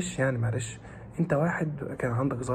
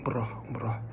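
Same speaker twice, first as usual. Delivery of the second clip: soft whisper, softer; soothing whisper; 3 semitones lower, 6 dB quieter than usual. Real, not acted. Teacher calming stressed students in a soothing whisper. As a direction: whisper it to calm them down.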